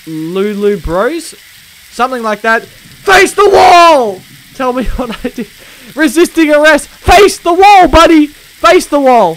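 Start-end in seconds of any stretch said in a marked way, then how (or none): 2.6-4.2 loud, high and very slow order; drawn-out furious yell. Pretend. Cop yelling at suspect. 6.9-9.4 loud and slow, then easing off; fading shouted order. Pretend. Repeating order at suspect.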